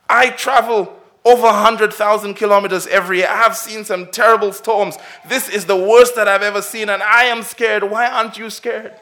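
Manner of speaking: a grown man admitting his fear mid-storm; scared businessman. Acted. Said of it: "And he was scared".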